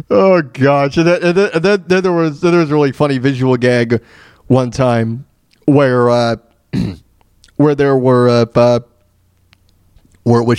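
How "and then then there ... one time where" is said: ordinary talk, nothing marked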